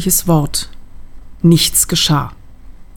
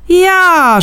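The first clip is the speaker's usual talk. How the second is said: hoher, lauter Schrei „Ja“; geschrien; 12 semitones higher, 4 dB louder than usual. Acted. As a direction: dem Gegenüber triumphierend entgegengeschrien. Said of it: "schrie mir mein Spiegelbild entgegen". (das Spiegelbild schreit die Erzählerin an, hoch und laut)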